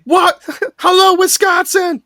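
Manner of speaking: loud, very high sitcom holler; Kelso. Retold or imitated. Quoting a seventies sitcom catchphrase, high exuberant shout. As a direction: yell it out in a goofy, high-pitched imitation. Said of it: "you have Kelso saying".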